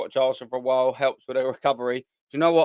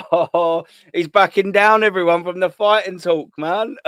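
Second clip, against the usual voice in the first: laughing excitement; 5 semitones higher, 7 dB louder than usual. Real, not acted. Laughing at the feud in chat.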